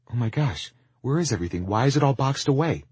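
high, loud, startled questions; shocked questioning. Acted. Lizzie sees the store boxed away, high-pitched shock. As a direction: react with alarm, voice climbing.

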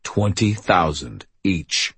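slow, deep man's voice; heavy, unhurried deep voice; deep male voice. Acted. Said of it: "a very deep male voice said".

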